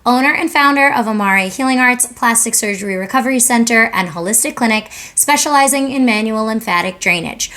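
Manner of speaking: loud, bright-paced promo intro read. Acted. Owner reading her clinic promo to viewers, upbeat ad read. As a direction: announce the business like a commercial spot.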